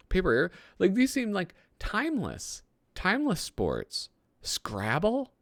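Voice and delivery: high, disbelieving questions; puzzled and incredulous, voice climbing high. Real, not acted. Baffled by the magazine's choices.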